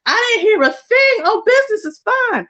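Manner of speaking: bright fake-breezy cover-up; mock denial. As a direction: pretend everything's fine in a sing-song.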